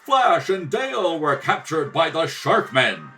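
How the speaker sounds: usual speaking voice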